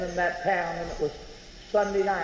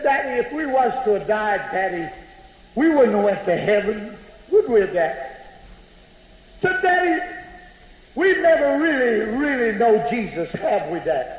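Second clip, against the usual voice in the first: put-on frightened young girl; 4 semitones higher than usual. Twelve-year-old girl in the storm cellar pleading with her father. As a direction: plead with Daddy like a scared child. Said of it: "my little twelve-year-old girl looked up at me and said".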